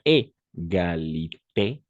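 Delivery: égalité stretched slowly with rising end; slow model word. Shown as a demonstration. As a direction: drag out égalité for the class. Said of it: "La E nuestra". (égalité lenta, de ejemplo)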